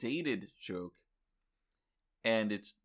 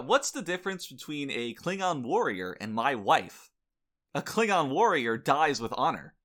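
loud stand-up punchline voice; old borscht belt comic; 4 semitones higher, 7 dB louder than usual. Pretend. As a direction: sell the punchline to the crowd. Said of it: "I'll do my own set for you".

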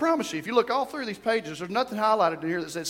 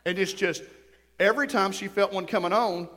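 ordinary talk

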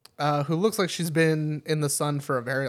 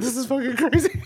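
laughing; 8 semitones higher than usual. Retold or imitated. Laughing retold thought.

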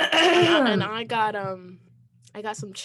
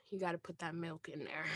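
dropped to a near whisper; hushed aside; 3 semitones lower, 9 dB quieter than usual. Real, not acted. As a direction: say it low and secretive. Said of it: "Why did you say it like that?".

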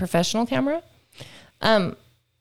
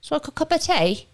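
put-on trashy British accent; 6 semitones higher than usual. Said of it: "See, that's trashy".

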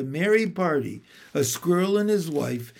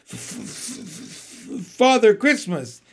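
terrified stammer; 3 semitones higher than usual. A narrator's reading. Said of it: "stammered the fox".

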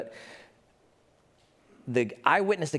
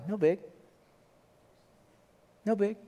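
usual speaking voice

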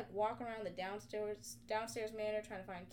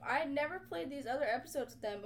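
usual speaking voice

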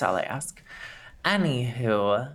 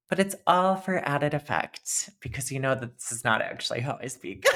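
ordinary delivery

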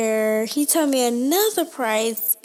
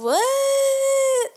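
high, long drawn-out "what"; incredulous, held high; retold disbelief; 12 semitones higher than usual. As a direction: stretch it out high in mock disbelief. Retelling own shocked reply to price.

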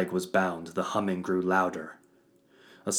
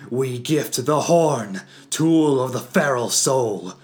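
shouting; 6 semitones higher, 9 dB louder than usual. Acted. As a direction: shout over a roaring hum. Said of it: "shouting over the dull roar".

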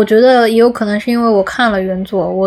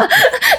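high laugh. Real, not acted. Laughs at roll call joke.

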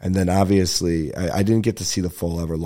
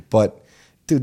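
ordinary talk

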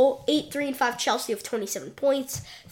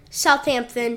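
ordinary talk

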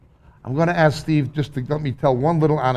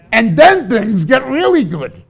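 joyful preaching; 9 semitones higher, 9 dB louder than usual. Real, not acted. Joyful climax.

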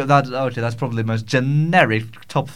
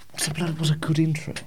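fast, breathy whisper under his breath; muttered aside not meant for the mic; whispering to himself; 4 semitones higher, 4 dB quieter, faster than usual. Real, not acted. Host alone in the studio whispering, hunting for an intro. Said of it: "Yes, I talk to myself".

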